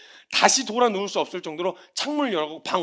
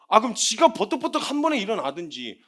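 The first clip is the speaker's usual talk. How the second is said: ordinary delivery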